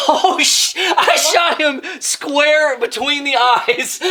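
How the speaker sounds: high, cracking-up disbelief; shocked and delighted, voice shooting up; laughing exclamation. Real, not acted. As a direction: blurt it out through real laughter. Accidentally shot crew member, laughing.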